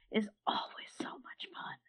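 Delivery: whispered aside; whispering. Real, not acted. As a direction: whisper the end. Drops to a whisper.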